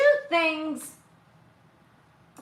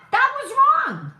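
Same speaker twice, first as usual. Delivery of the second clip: much higher and louder, outraged; indignant shout; 11 semitones higher, 6 dB louder than usual. Real, not acted. Ranting to viewers about network coverage, shouting. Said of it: "repeats for emphasis".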